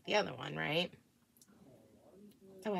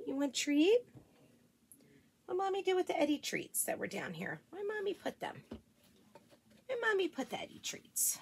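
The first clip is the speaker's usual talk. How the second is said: pet-talk voice; 7 semitones higher, 5 dB quieter than usual. Real, not acted. Mom greeting her dogs in high baby talk.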